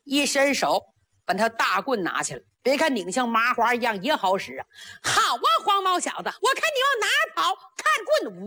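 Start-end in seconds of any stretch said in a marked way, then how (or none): 5.0-8.4 higher, quick, cocky battle taunt; a fierce girl crowing over her foe; taunting young warrior girl. A narrator's reading. Hua Fengxian taunting the boy mid-fight, high and quick.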